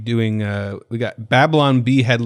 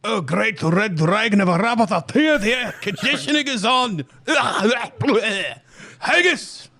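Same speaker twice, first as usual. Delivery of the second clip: much higher, hammed-up Scottish accent; 11 semitones higher than usual. Shown as a demonstration. Host doing a segue on request, comic Scottish accent. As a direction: go shrieky and over-the-top Scottish. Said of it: "next part of this interview in Scottish".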